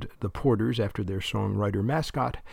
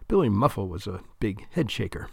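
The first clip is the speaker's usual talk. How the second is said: ordinary delivery, nothing marked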